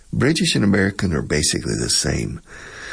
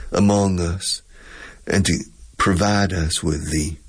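Southern US accent. Shown as a demonstration. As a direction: play a gracious Georgia host on the radio. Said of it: "talk like this".

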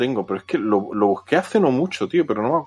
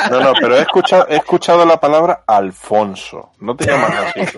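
teasing through laughter, higher; laughing; 5 semitones higher, 5 dB louder than usual. Real, not acted. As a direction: laugh while poking fun. Teasing about the name Alfonso. (burlón, entre risas)

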